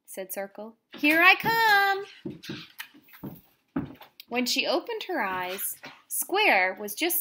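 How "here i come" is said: calling out loud. Acted. Counting done, seeking game starts.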